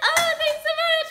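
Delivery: high singsong mock thanks; hammed-up sugary thanks; mocking Deborah. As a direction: sing it up high and fake-sweet. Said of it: "she's like".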